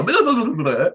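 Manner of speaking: high, loud babble like someone rattling off Italian; teasing impression of a local talking Italian at him; mock Italian gibberish. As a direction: parody a fast Italian speaker you can't follow. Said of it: "And they'll just go".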